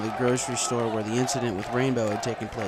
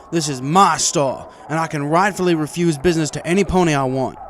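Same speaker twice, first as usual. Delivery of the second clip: defiant shopkeeper; 4 semitones higher, 8 dB louder than usual. A narrator's reading. Marty insists loudly to Celestia, defiant. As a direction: dig in and defend your rights. Shopkeeper defends refusing service.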